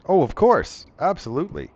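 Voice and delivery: eager crocodile man. Acted.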